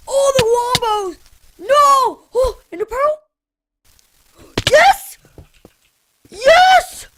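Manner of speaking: high, loud victory yells; excited shouting. Real, not acted. Gamer celebrating a lucky win, yelling loud and high. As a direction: scream with joy at the clutch. Pearl clutch wins the fight.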